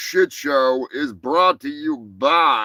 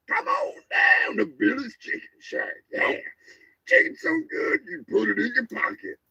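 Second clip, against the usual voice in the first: hammed-up commercial announcer voice; mock ad read; 3 semitones higher than usual. Host reads the show's fake sponsor ads in a hammed-up ad voice. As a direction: sell the sponsors like a cheesy ad.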